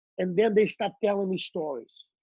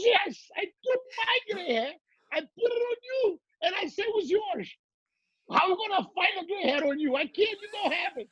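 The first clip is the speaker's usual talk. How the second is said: much higher voice, matter-of-fact; teasing daughter; 11 semitones higher than usual. Retold or imitated. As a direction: play the cheeky daughter in a high voice. Daughter explains the prank.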